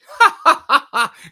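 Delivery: loud, high burst of ha-ha laughter. Real, not acted. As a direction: laugh out in a loud staccato run. Laughing while reading the chat.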